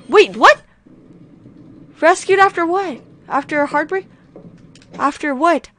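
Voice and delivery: high puzzled questions; baffled by the headline; confused surprise. Real, not acted.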